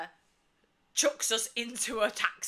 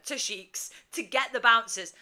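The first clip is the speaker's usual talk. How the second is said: ordinary talk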